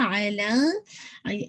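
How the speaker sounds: normal talking voice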